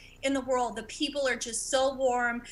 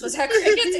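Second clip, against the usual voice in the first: louder, higher repeat; 8 semitones higher, 7 dB louder than usual. Real, not acted. Joke met with silence.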